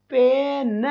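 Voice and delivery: "पेन" stretched, final न set apart; teacher breaking "पेन" into its sounds; sounding out. Shown as a demonstration. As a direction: draw out the vowel in "पेन", then land on न. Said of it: "और न का symbol क्या होता है".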